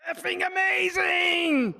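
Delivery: high, slow, ecstatic shout; mock jubilant yell, drawn out; backstage shout. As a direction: let it all out once the mic is off. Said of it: "She went back in the backstage and she goes".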